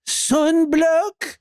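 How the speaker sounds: high, put-on voice for the saleswoman; Italian clerk correcting him, mock high voice. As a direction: answer flatly as the clerk. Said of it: "she looks at me and goes".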